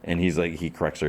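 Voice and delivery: ordinary delivery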